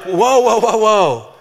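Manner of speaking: loud alarmed whoa easing into a slow, steady ask; startled parent. Retold or imitated. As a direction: parent catching a frantic child, then calming them. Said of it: "And you're like".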